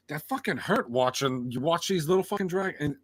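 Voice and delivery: usual speaking voice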